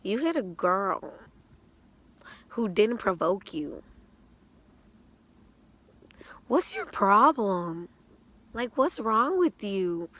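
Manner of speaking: emphatic telling-off with long pauses; retold scolding. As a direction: act out slow, loud, indignant reproach. Retold scolding of man who hit her.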